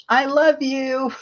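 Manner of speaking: yelled out. Retold or imitated. Mother yelling to a family in a parking lot, playful. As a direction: call it out playfully to strangers. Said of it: "And so I yell out".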